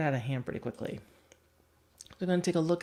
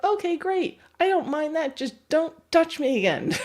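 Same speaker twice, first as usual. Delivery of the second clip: high, louder, chirpy dog voice; content but wary dog; 12 semitones higher, 5 dB louder than usual. Retold or imitated. Dog to the trainer, high chirpy voice with a warning. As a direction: agree but set a limit. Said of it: "the dog's like".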